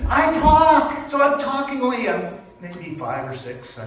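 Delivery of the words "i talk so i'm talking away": excited burst. Retold or imitated. Joyful chatter as a child.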